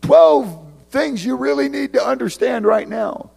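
overzealous letter writer. Retold or imitated. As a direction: ham up an overeager preacher. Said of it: "it would be like you".

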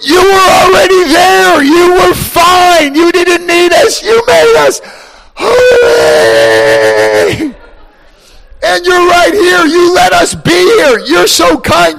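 high, loud adoring cry; awed creatures. Acted. Creatures adore God, high and loud. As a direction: praise him like beings lost in awe.

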